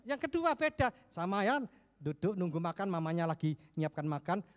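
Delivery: ordinary talk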